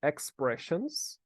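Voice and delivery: slow, drawn-out Expressions; slow model word. Shown as a demonstration. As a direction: stretch it out for the class to repeat. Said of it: "repete aqui comigo".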